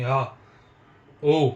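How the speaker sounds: sudden loud "हा, ओ"; loud shout demo. Shown as a demonstration. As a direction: shout out to show what noise is. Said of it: "जब हम जोर से बोलेंगे". (शोर दिखाने के लिए ज़ोर से चिल्लाना)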